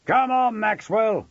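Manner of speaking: high, loud call from a crowd; angry heckler calling someone out; shout. A narrator's reading. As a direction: shout up at the window. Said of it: "he heard one of them shout".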